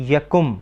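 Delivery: यकुम said slowly, clear u on the kaf, ya-KUM; careful Persian yakum. Shown as a demonstration. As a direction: pronounce यकुम with a full u sound. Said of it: "इसके तलफ़ुज़ पे गौर करें".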